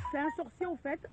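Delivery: ordinary talk, nothing marked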